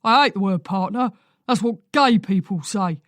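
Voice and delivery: husband scolding his wife over a word; grumpy old man. A narrator's reading. Angry old man ranting.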